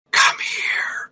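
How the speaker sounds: put-on eerie whispered little-girl voice; creepy girl whisper. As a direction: breathe it like a ghost girl in headphones. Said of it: "the creepy girl voice in my headphones going".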